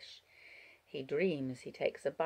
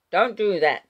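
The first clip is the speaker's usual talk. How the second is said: louder, higher startling outburst; sharp cry; 6 semitones higher, 11 dB louder than usual. A narrator's reading. Wallace making the child jump, crying out. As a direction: cry out suddenly to stop her. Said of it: "cries Wallace, making her jump".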